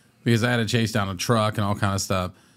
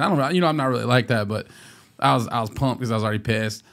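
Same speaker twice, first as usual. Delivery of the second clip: ordinary talk, nothing marked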